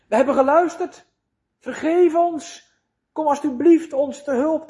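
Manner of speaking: a soul crying out for rescue; pleading cry for help. Acted. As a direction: beg for mercy, voice lifted.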